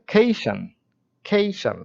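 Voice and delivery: খেইশন lifted high as the stressed syllable. Shown as a demonstration. Teacher lifts খেইশন high to show viewers the stress. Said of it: "major স্ট্রেসটা কোথায় রয়েছে?".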